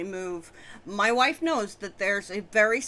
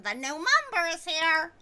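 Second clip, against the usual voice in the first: high announcing voice; character voice; 8 semitones higher than usual. Acted. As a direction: announce the new member. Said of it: "Let me try that again".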